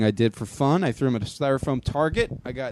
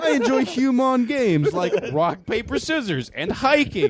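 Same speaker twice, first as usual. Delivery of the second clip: high, slow, stilted alien; 9 semitones higher, slower than usual. Acted. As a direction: speak like an alien faking human. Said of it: "things an alien says".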